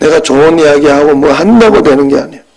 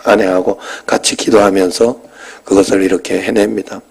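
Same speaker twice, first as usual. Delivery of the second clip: normal talking voice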